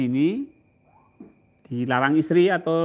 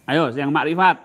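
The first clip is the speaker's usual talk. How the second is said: ordinary talk, nothing marked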